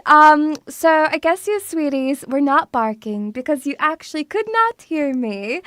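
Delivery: normal talking voice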